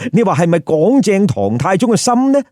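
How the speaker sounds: usual speaking voice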